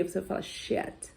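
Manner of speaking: sheet with a short e like "shet", whispered. Shown as a demonstration. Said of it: "você vai falar com som de E".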